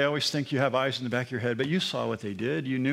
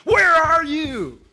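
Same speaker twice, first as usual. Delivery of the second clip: huge, slow, much higher and louder call; booming voice of God; 14 semitones higher, 10 dB louder, much slower than usual. Pretend. Preacher imagines an angry God calling out to Adam, booming. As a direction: thunder it down from heaven like a wrathful God. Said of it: "Adam didn't hear God saying".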